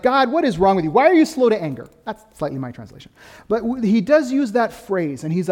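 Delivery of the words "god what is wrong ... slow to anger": higher, louder, exasperated complaint thrown at God; hammed-up indignation, throwing it back at God; Jonah's angry retort. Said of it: "and he's like".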